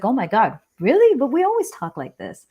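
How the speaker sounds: high-pitched retold disbelief, peaking on "really"; surprised, voice leaping up then settling; taken aback. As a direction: act out startled protest to a friend. Retold disbelief at friend's hurt.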